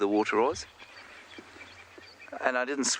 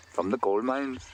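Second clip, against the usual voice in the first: clumsy attempt at Welsh; hopelessly off-target Welsh lilt; bad Welsh accent. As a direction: try a Welsh accent and miss. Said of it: "can't do a Welsh accent".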